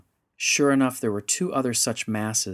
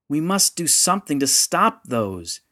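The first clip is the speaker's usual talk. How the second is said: raised, loud, urgent voice; shouting over noise; 6 semitones higher, 6 dB louder than usual. A narrator's reading. Yanfrey to Rendra over the noise of fear, shouting. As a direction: shout to carry above a panicked crowd. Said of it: "Yanfrey shouted above the noise".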